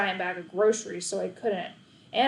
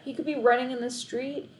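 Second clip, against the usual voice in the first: plain reading voice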